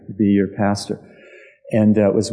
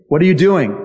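sharp, louder, much higher demand; police demand; 8 semitones higher, 5 dB louder, faster than usual. Retold or imitated. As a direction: bark it like a suspicious policeman.

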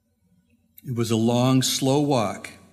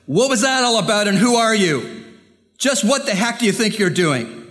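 furious yelling, much higher and louder; angry shout; 8 semitones higher, 3 dB louder than usual. A narrator's reading. Angry driver shouting at the boy who hit his car. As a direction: bellow at the kid who threw a brick. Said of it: "The angry driver ... shouted".

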